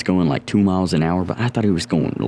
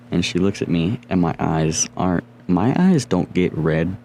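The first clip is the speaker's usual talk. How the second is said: normal talking voice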